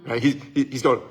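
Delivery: ordinary talk, nothing marked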